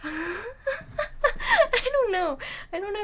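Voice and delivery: loud, high, real laughter; laughing in confusion. Real, not acted. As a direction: wail it through helpless laughter. Laughing at confusing pronunciation clips.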